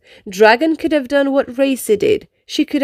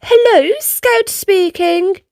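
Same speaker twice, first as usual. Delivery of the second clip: friendly phone greeting; 7 semitones higher, slower than usual. Acted. Scout answers the phone.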